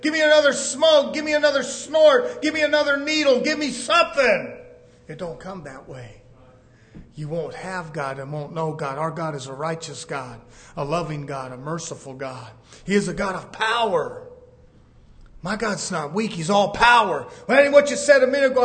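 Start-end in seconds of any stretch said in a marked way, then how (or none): 0.0-4.6 high, pleading greedy demands; mock greedy churchgoer. Mocking worldly churchgoers' demands. 17.5-18.6 fast, high retort; mock heckler. Imagined objector interrupts fast.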